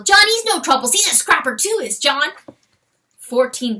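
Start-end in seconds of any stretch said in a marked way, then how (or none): 0.0-2.3 high loud weasel voice; cried. Acted. Weasel calling to the stag, high excited voice. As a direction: reassure loudly, much higher.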